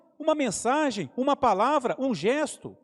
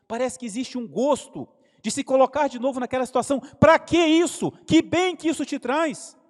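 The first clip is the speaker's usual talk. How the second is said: loud, high rhetorical challenge from the pulpit; forceful sermon voice, rising up; preaching at full force; 7 semitones higher, 7 dB louder than usual. Acted. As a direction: press the congregation hard with questions. Preacher rebukes dwelling on hurt.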